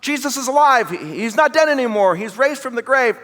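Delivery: high, eager breaking of good news; excited announcement, pitched well up. Acted. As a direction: burst out with thrilling news to a friend. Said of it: "They're really excited".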